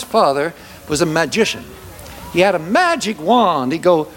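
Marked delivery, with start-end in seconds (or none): none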